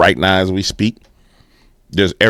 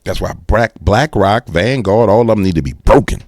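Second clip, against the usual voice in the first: normal talking voice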